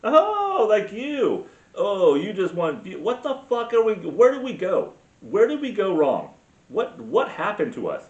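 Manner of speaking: high, indignant cry at the clip; exasperated outburst. Real, not acted. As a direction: erupt in scornful disbelief. Sarcastic outburst at the played clip.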